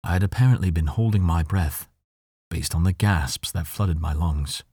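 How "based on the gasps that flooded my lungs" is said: ordinary delivery, nothing marked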